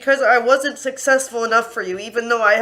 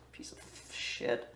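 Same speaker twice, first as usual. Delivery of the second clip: whispered insult, much lower; bitter whisper; 13 semitones lower, 21 dB quieter than usual. Real, not acted. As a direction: hiss it under your breath. Insult muttered under her breath.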